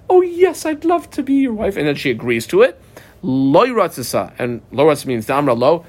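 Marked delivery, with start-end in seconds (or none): 0.1-1.8 high, bright mock-eager acceptance; eager yes. Teacher imitating an eager woman accepting the proposal, mock excited. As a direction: gush like a delighted bride-to-be. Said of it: "where she says".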